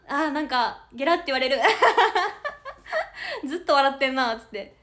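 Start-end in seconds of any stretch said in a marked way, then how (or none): 1.6-3.4 loud high-pitched real giggling; real giggle fit. Real, not acted. Streamer laughing at viewers calling her a giggler, high loud giggle. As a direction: burst out giggling at the comment. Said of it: "ゲラっぽいな".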